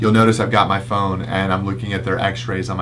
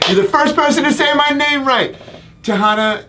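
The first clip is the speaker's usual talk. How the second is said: very high-pitched, loud excited squeal; excited outburst; 4 dB louder than usual. Acted. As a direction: squeal it out in over-the-top delight. Excited outburst at viewer's compliment.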